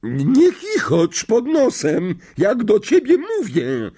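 high, loud, slow rebuke; thundering. A narrator's reading. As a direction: boom at the smirking boy. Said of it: "zagrzmiała ciotka Merch". (grzmi na chłopca)

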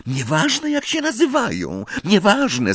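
high, agitated outburst; hysterical cry. A narrator's reading. Girl interrupting talk of attackers' names, hysterical. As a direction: cut them off in a high, hysterical voice. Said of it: "zawołała Hermiona histerycznym głosem".

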